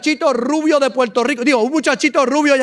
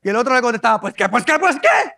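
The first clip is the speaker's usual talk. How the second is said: Mexican quarrel; faster than usual. Retold or imitated. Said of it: "una pelea de dos mexicanos".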